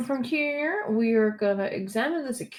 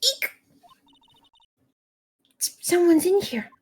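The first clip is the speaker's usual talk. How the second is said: put-on high girl voice; 11 semitones higher, 4 dB louder than usual. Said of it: "stop with the high-pitched voice".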